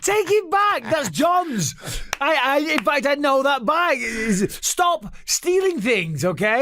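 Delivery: very high, pleading retold reprimand; exasperated scolding. Telling a friend to stop stealing things, exasperated. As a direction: plead high, drawing out the final order.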